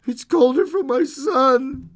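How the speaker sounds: high, loud tearful wail; weeping. A narrator's reading. Old woman grieving for her son, weeping. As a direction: wail it slowly through tears. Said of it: "and wept afresh".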